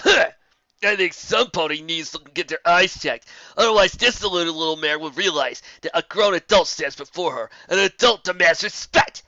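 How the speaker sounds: loud, slow, scornful griffin; griffin sneer. A narrator's reading. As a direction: talk down to the little mare. Geller's scornful retort.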